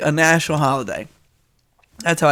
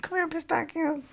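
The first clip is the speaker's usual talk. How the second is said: sister calling the dog; 12 semitones higher, 12 dB quieter than usual. Retold or imitated. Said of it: "So she's like".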